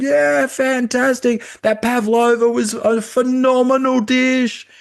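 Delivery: high, loud, drawn-out crowd cheer. Acted. Stadium crowd applauding the cook's pavlova, loud high cheering. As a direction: roar approval like a thrilled stadium. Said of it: "they give him this big, massive applause. They're all saying".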